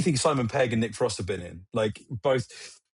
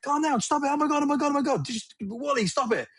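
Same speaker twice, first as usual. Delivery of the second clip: pleading with dog; 13 semitones higher, faster than usual. Retold or imitated. Telling barking dog to calm.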